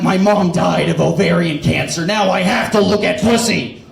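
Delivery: vengeful superhero voice, put on; Batman. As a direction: brood like a comic-book vigilante with a mission. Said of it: "it's like his Batman level thing".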